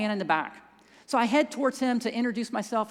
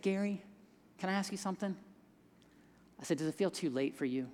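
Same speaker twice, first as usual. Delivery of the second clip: gentle bedside voice; 3 semitones lower, 9 dB quieter than usual. Retold or imitated. Speaking gently to a dying man.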